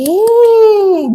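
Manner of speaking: long, high "yay"; delighted cheer. Real, not acted. Mother cheering toddler for the umbrella, delighted. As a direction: stretch a high cheer out slowly.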